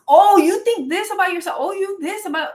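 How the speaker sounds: high, loud mocking whine of the accuser; hammed-up mimicry of a whiny complainer; mocking imitation. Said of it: "Now it's".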